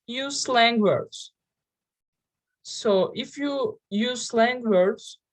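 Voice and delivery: ordinary talk, nothing marked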